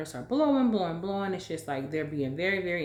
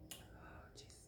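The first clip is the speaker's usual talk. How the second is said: breathy hushed gasp of relief; whispered oh jeez; 26 dB quieter than usual. Retold or imitated. Onlookers whispering in relief at the roadside. Bystanders react to near crash.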